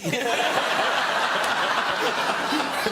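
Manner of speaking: airy high burst of laughter; helpless airy giggle; high breathy laugh. Real, not acted. Laughing at his own story.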